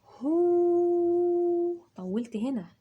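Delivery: هو held long and high; long vowel. Shown as a demonstration. Teacher holding the long oo for kids. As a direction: draw out the oo of هو. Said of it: "يبقى مد بالواو".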